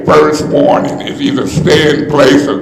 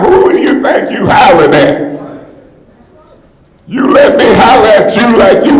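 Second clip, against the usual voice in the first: raised voice demanding an answer; real indignant shout; 6 semitones higher than usual. Real, not acted. Council member shouting back at the chair, angry. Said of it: "and I'm saying it now".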